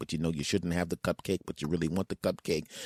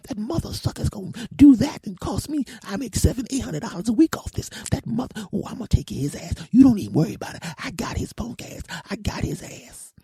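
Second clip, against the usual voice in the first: angry woman's rant; 5 dB louder than usual. Retold or imitated. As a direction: rant way up high about the snitch. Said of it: "her voice went from this to this".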